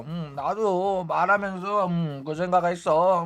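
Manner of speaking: put-on slow, slurred drunk mumble; drunk slurring. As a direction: mimic a tipsy friend's tangled tongue. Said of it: "술 취해가지고 혀가 막 꼬인 거야".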